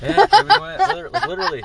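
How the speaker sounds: high, loud laughing through the words. Real, not acted. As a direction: crack up while saying it. Cracking up at own joke.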